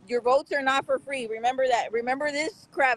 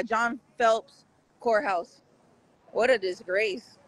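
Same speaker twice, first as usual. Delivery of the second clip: normal talking voice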